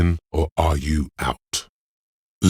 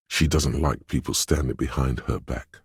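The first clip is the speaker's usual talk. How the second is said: usual speaking voice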